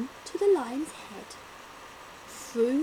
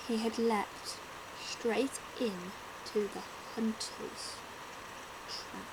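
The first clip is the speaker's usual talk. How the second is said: soft, gasping, falling to nothing; hushed suspense; 2 semitones lower, 8 dB quieter than usual. A narrator's reading. Narrator hushes as lion leaps into trap. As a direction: drop to a hush as he falls in.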